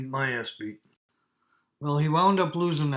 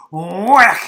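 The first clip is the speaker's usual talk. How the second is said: sharp whack sound; 4 semitones higher, 13 dB louder than usual. Retold or imitated. Second slap, imitated hit.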